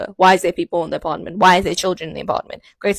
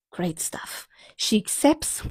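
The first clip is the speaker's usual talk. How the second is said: sarcastic whisper, much softer; whispered aside; 2 semitones higher, 6 dB quieter, much slower than usual. Real, not acted. Telling viewers how it ended, whispered wryly. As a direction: murmur it under your breath.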